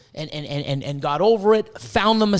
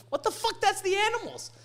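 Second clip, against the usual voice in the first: softer, squeaky-high mock astonishment; retold amazement; 13 semitones higher, 5 dB quieter than usual. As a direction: act out being stunned, voice shooting up high.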